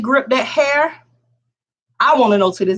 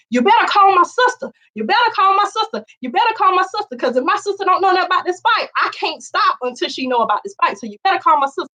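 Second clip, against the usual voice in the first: high, insistent holler; hollering demand; 8 semitones higher than usual. Retold or imitated. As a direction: order her to call the sister. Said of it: "I'm hollering at the girl".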